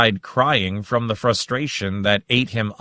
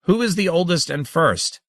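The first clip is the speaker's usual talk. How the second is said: demanding; 8 semitones higher than usual. A narrator's reading. Saren presses the laughing creatures again, high and insistent. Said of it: "Saren demanded".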